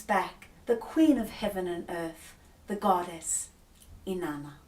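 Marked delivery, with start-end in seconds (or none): none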